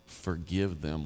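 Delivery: soft, slow forgiving plea; quiet, slow and tender. Retold or imitated. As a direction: ask gently and slowly. Jesus quoted tenderly.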